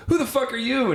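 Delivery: high-pitched startled outburst; startled demand. Retold or imitated. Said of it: "she's like".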